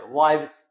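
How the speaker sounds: "वाइव्स" with a v sound and a stressed end; teacher models "वाइव्स"; correct plural demo. Shown as a demonstration. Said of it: "नहीं तो stress पड़ता है वाइव्स".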